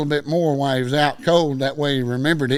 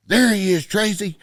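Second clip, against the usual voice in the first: loud, high holler; imitated call-out; 7 semitones higher, 5 dB louder than usual. One guy in the mob spotting him, retold holler. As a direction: call it out like spotting your man. Cowboy calling out across parking lot.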